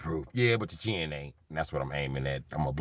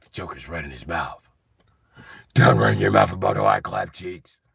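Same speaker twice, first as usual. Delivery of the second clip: whispery Batman; 5 semitones lower, 5 dB louder than usual. Retold or imitated. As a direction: purr it out like a creepy hero. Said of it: "He's like".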